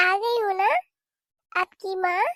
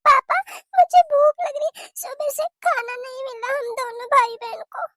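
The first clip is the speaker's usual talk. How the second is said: put-on high child voice, loud at first; hungry child whining to papa; complaining little child; 7 semitones higher, 7 dB louder than usual. Child tells father.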